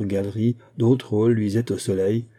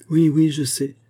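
a touch of a breaking voice; broken voice; 6 semitones higher, 3 dB louder than usual. Acted. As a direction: voice cracking with hurt. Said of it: "reprit-il d'une voix brisée". (voix brisée)

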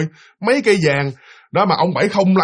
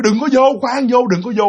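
higher, louder urgent shout to stop; shaman yelling; 8 semitones higher, 3 dB louder than usual. Retold or imitated. Shaman yelling at the husband not to come in. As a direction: shout him back from the door. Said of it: "ông thầy ông la liền".

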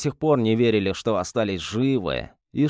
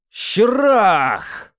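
eldritch horror roar; 9 semitones higher, 5 dB louder than usual. Acted. Demon bursts out of hero.

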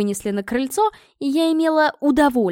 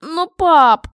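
loud, high plea to Dad; high, imploring wail; pleading; 6 semitones higher, 8 dB louder than usual. Acted. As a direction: beg in protest. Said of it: "взмолилась я".

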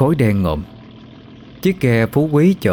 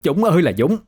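high-pitched, scandalized tirade; outraged village-woman complaint; indignant sister; 8 semitones higher, much faster than usual. Acted. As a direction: denounce her to the whole village, quick and high. Sister publicly denounces Lệ before villagers.

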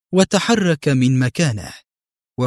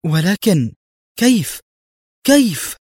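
high, baffled questioning; bewildered amazement; astonished; 7 semitones higher, 3 dB louder than usual. A narrator's reading. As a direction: let the pitch leap higher with each question. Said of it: "اتسعت عيناها في دهشة وهي تغمغم".

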